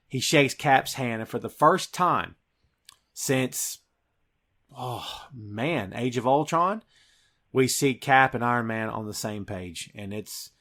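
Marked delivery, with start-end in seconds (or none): none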